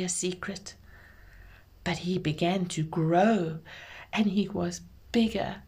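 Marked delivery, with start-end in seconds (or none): none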